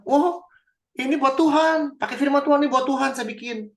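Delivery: high, loud mock-pious protest; pious excuse. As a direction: play the king feigning piety. Voicing Saul's pious excuse. (alasan sok saleh, tinggi dan keras)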